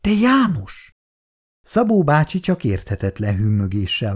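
put-on complaining old wife, mildly put upon; lightly voiced elderly wife grumbling at night. A narrator's reading. Szabó néni's voice.

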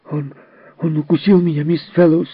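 put-on high, loud angry boy; breathless with anger, cry it out; angry child's cry. A narrator's reading. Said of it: "задыхаясь от злости, крикнул Джерри".

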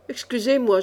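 usual speaking voice

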